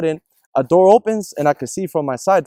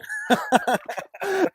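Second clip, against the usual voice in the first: soft high laugh; 11 semitones higher, 7 dB quieter than usual. Real, not acted. Telling his cohost about the apology, soft high laugh. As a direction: laugh in disbelief.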